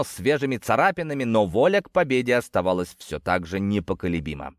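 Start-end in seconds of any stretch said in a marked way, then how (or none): none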